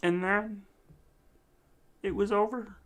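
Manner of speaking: mock-menacing tale; lightly voiced spooky villain; spooky storyteller. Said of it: "I am the freak show".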